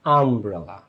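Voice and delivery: আমব্রেলা said with an open 'a' start, not 'yu'; a sound demo. Shown as a demonstration. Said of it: "আ হয়ে যাচ্ছে".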